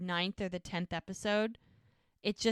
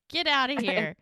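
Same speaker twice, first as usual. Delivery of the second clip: raised-voice playful dismissal; shooing him away; 4 semitones higher, 6 dB louder than usual. Retold or imitated. Bride sending the groom away from friends, playful shooing. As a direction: imitate shooing someone off. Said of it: "she's, like, shooing him away".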